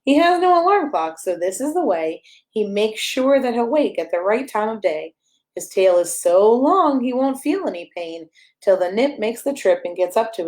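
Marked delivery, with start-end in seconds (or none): none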